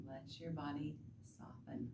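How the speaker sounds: hushed, breathy fading to a whisper; soft spa whisper. Acted. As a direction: whisper it gently as they exhale. Soothing guided relaxation cue.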